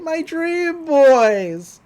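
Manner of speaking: very slow, high, hammed-up mourning; swooning lament. Mabel at the edge mourning her dream boys, slow and high. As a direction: draw it out like a heartbroken fan.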